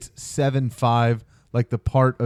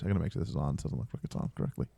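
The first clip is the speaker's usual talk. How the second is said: quick whispered aside; 7 semitones lower, 14 dB quieter, much faster than usual. Real, not acted. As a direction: mutter it under your breath. Aside while fixing setup.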